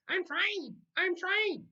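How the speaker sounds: very high squawking bird voice; mynah bird. A narrator's reading. The mynah bird greeting everyone in French, high bird squawk. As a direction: squawk it like a parrot. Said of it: "Uncle Feather repeated".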